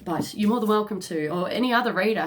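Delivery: usual speaking voice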